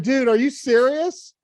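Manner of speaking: high and loud, can't believe it; disbelieving. Retold or imitated. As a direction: ask it half-shouting in disbelief. Retelling his disbelief at stranger.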